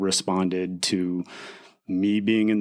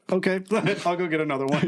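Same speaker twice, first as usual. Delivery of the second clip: higher, quick, laughing mock reply; Bobby's bemused reply; 9 semitones higher, faster than usual. Bobby shrugs off his stolen cart, higher, fast, laughing. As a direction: toss it off with a laugh, pitched up. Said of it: "cocked his head, like".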